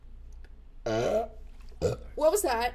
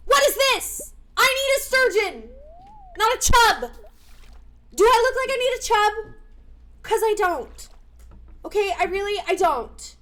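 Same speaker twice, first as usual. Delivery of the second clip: high, loud exasperated rant; loud, high-pitched indignation; indignant outburst; 9 semitones higher, 8 dB louder than usual. Real, not acted. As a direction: yell your complaint at the game. Ranting at unwanted fish in game.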